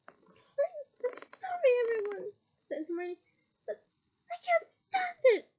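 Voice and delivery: high, loud tearful apology; high loud mock sobs; fake sobbing. A narrator's reading. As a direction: pretend to break down crying.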